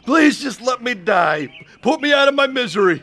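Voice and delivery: high, loud, desperate plea; anguished wailing. Acted. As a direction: beg to die in agony. Sick man begging to die.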